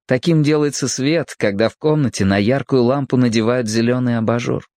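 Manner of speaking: plain reading voice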